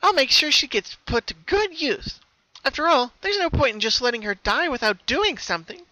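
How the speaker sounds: high, bright-toned cheerful pony voice; high cheerful cartoon pony; Pinkie Pie voice. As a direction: play the sweet pony with a sinister calm.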